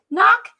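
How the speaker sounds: high, loud knock call; knock sound. Acted. Knock at the door in story.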